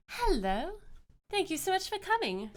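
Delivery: high-voiced friendly deity; joyful goddess. Game master voices the goddess.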